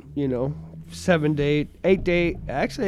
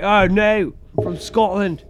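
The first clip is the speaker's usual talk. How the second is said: put-on rough, half-managed Scottish accent, pitched up; attempted Scottish accent; 4 semitones higher, 5 dB louder, slower than usual. Joking with co-host about Scotland, attempted Scottish accent. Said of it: "work in that good Scottish accent".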